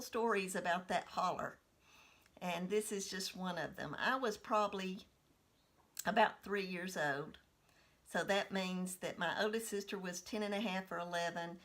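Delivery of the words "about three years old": ordinary talk, nothing marked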